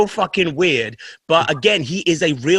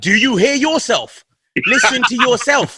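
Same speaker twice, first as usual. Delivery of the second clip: higher-pitched mock disbelief; incredulous retort; 7 semitones higher, 3 dB louder than usual. Comedian's defender answering an accent critic, incredulous retort. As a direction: throw the criticism straight back, drawn out. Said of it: "I'm like".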